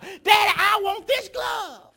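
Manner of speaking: put-on high, loud childish yell, slow on "I want this glove"; a kid throwing a fit in the store aisle; whining child yell. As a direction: son yelling at his daddy in the store, high and loud. Child insists on cheap glove.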